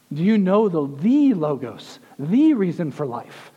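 "the" drawn out as a long, emphatic "thee"; slow, weighty stress on each "the" before "Logos" and "reason". Real, not acted. Preacher challenging believers on the reason for life, "the" stressed hard.

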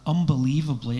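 normal talking voice

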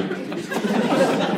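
breathy chuckle at his own joke; quick unvoiced laugh; airy laugh. Real, not acted.

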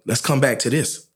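ordinary talk, nothing marked

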